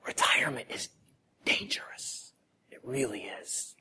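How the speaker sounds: hushed aside, breathy and unvoiced; whisper. Real, not acted. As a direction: lean in and whisper the warning. Side note whispered to congregation.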